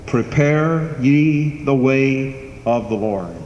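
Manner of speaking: slow, solemn scripture reading; intoned scripture. Acted. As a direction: draw out the prophet's call slowly. Quoting Isaiah, intoned scripture.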